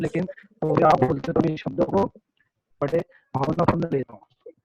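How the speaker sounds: normal talking voice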